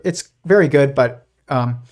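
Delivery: ordinary talk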